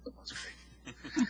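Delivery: slow, breathy, dripping with sarcasm; sarcastic. Acted. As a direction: drag it out, mockingly unimpressed. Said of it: "Oh, sarcasm".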